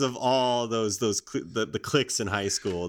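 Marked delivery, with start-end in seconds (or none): none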